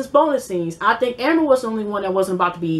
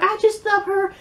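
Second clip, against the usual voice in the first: mock gushing quote; 6 semitones higher than usual.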